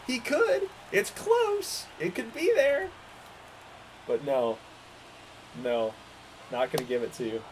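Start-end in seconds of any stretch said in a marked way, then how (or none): 0.0-2.9 much higher, excited rising voice; excited squeal. Real, not acted. Streamer calling the fly ball to his viewers, excited and much higher. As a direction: pitch up with hope as the ball flies.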